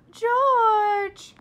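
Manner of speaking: loud high call across the house; shout. A narrator's reading. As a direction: yell for him from the kitchen. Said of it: "shouted Flora's mother".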